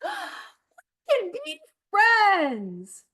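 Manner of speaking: high, loud and very slow with delight; excited, drawn out. Acted. Duckling to crocodile, thrilled at the idea. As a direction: stretch it out like the best news ever.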